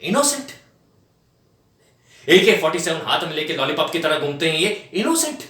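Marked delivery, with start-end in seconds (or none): 0.0-0.5 high, incredulous echo of one word; mocking disbelief. Scornful challenge of calling suspects innocent. 4.9-5.4 high, incredulous echo of one word; mocking disbelief. Repeats the word in scorn.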